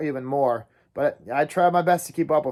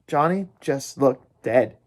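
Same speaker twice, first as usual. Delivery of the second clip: ordinary delivery, nothing marked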